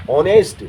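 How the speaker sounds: "ऑनेस्ट" said with a clear open O and no H sound; "ऑनेस्ट" as teacher demo, O sound first; silent h in honest. Shown as a demonstration. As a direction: start "ऑनेस्ट" straight on the vowel, dropping the H. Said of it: "sound में ओ आ रहा है".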